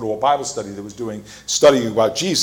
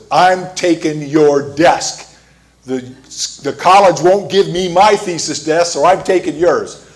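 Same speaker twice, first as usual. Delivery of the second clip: slow, louder and higher demanding voice; retold yelling; 4 semitones higher, 5 dB louder, slower than usual. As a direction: bark it at her like a bully taking her desk. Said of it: "yelled at her and said".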